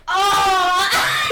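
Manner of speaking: long, high, loud groan; dismayed outcry. Acted. Merlin hearing his fate from Tisha, hammed-up loud dismay. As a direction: wail it in mock despair.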